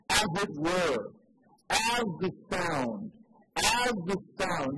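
"as" drawn out slowly and leaned on; slow, deliberate "as the sound"; stress on as. Shown as a demonstration. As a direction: hammer the word "as" each time. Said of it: "You see the word there, "as," mentioned three times".

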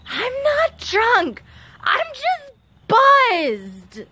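put-on high, slow, drawn-out valley girl.

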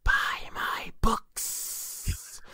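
high, slow eerie whisper. Acted. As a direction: breathe it like a ghostly whisper. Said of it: "General heard a whisper".

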